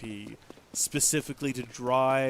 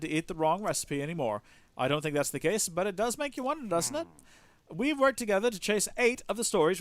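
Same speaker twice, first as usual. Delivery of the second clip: animated, higher character voice; enthusiastic reporter partner; 6 semitones higher, 7 dB louder than usual. Acted. Newspaper reporter congratulating a partner on an investigation, enthusiastic. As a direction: hail your partner loudly, then chat eagerly.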